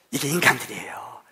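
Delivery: hushed whispered punchline. Real, not acted. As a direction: lean in and whisper it. Sermon point whispered. (속삭이듯)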